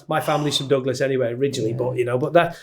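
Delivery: ordinary talk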